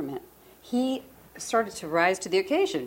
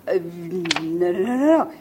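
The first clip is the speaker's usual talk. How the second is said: balking refusal; 6 dB louder, slower than usual. Retold or imitated. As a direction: build from reluctance to a loud protest. Acts out refusing to submit.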